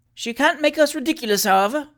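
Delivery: high-pitched, haughty dismissal; proud young man's scornful remark. A narrator's reading. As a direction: say it loftily, pitched well up. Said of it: "observed Mister Sempronius Gattleton haughtily".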